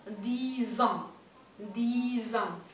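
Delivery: "dix ans" with the X said as a Z; liaison dix ans. Shown as a demonstration. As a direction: link "dix ans" as "di-zan". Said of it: "se va a pronunciar Z".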